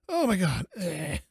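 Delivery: ordinary talk, nothing marked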